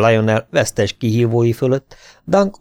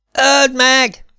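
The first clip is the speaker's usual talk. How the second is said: much higher, louder frantic yell; boy shouting; 4 dB louder than usual. A narrator's reading. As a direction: scream it at the knight from the crowd. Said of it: "kiáltotta hirtelen a fiú".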